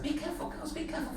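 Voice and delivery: high, soft, fussy repeated warning; posh worried grandmother. Retold or imitated. Imitating posh grandmother following the herd around, worried voice. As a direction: flutter it softly in a high posh voice. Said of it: "She's very worried about the herd".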